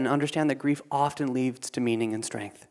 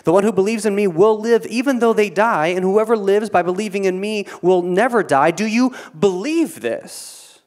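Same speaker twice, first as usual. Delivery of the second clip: louder, higher proclamation, slowing at the question; Jesus proclaiming; 7 semitones higher, 7 dB louder than usual. Acted. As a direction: announce it like Jesus to Martha. Reading Jesus's words with force.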